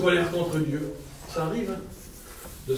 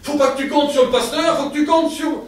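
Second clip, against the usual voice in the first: climbing higher and louder with each phrase; rising exhortation; 9 semitones higher, 7 dB louder than usual. Real, not acted. Preacher urging the church to trust God, building loud. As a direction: push the point home.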